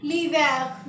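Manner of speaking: l'hiver repeated as lee-VAIR, silent h; French pronunciation. Shown as a demonstration. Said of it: "क्या बोलेंगे?". (l'hiver दोहराएँ, H silent)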